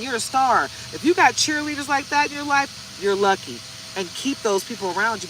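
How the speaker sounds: usual speaking voice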